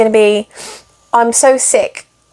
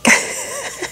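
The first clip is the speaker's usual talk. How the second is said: squeaky unvoiced laugh; a delighted high giggle; high breathy giggle; 7 dB quieter than usual. Real, not acted. Owner showing her cat to viewers, high giggle.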